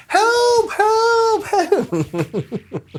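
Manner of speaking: very high, loud comic help calls; high cries for help. Retold or imitated. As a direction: squeal help like you're drowning. Friend swept down the rapids.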